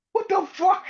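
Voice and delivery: high, loud incredulous yelp; confused. Retold or imitated. Punk still confused after Jay calms him, high yelp. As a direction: shoot the pitch up in confusion. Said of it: "It was so goddamn confused".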